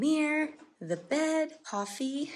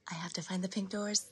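whispering; 2 semitones lower, 7 dB quieter, faster than usual. Retold or imitated. Retold own excited thought.

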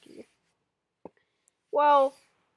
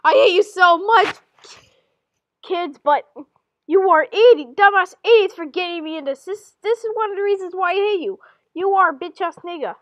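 loud, raised-pitch rant; hammed-up furious outburst; acted angry tirade; 5 semitones higher, 9 dB louder than usual. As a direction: lash out at the kids at high volume. Acted tearful tirade at the kids.

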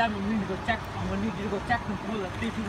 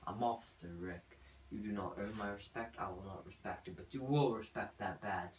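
very low, hushed threat; menacing; 12 semitones lower, 14 dB quieter than usual. Acted. Officer intimidating a suspect in interrogation, low quiet menace. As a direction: drop deep and quiet, full of authority.

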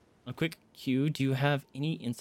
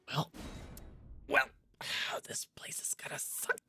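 soft, breathy sigh of a whisper; resigned whisper; 10 dB quieter than usual. Real, not acted. Resigned after dying in game.

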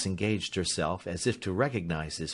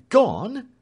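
much higher, louder, rising; stunned echo; 13 semitones higher, 8 dB louder than usual. A narrator's reading. Alfred repeats the dreadful news, stunned. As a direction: echo it in disbelief. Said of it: "he echoed".

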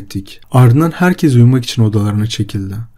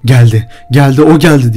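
raised, urgent alarm; frightened yelling; 3 semitones higher, 4 dB louder than usual. A narrator's reading. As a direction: cry out in fear to wake someone. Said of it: "bağırmaya başladım".

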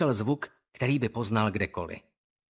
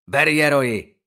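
loud curt order; barked; 8 dB louder than usual. Acted. Owen orders Roy to grab the cards, barked. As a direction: bark it at your sidekick. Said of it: "vyštěkl Owen".

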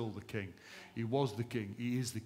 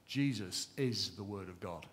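normal talking voice

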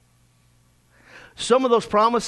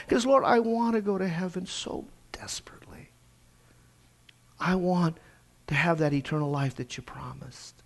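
softer, unvoiced whisper to God; whispered prayer; 4 semitones lower, 7 dB quieter than usual. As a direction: whisper the plea, hushed and intimate. Retold private prayer to God.

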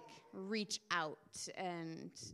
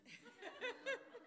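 laugh; 7 dB quieter than usual. Real, not acted. Laughing at weirdness.

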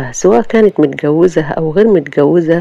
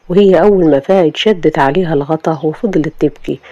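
ordinary delivery, nothing marked

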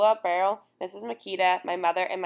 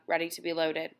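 usual speaking voice

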